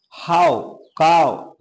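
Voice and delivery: हाउ and काव drawn out slowly; slow model of हाउ, काव; slow example words. Shown as a demonstration. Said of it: "reading example words".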